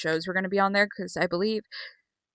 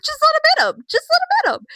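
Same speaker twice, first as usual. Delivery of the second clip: much higher, loud excited yell; 8 dB louder than usual. Retold or imitated. Fan yelling at the wrestler on TV, shrill shout. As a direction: scream it at the screen, cheering. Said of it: "and I was like".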